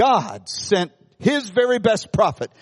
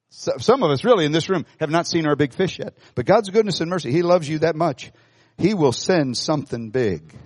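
ordinary talk

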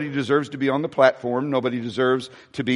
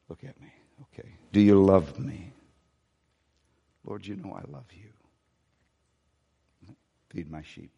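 very soft, much lower, near whisper; hushed tender dialogue; 7 semitones lower, 5 dB quieter than usual. Acted. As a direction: murmur it like a gentle father. Jesus and Peter, tender exchange.

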